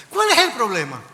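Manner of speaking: loud, high confrontational demand; angry, improper tone. Retold or imitated. Applicant snapping at the lieutenant over his wife, angry improper tone. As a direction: re-enact an outburst at full volume. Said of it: "Le hablé en un tono incorrecto".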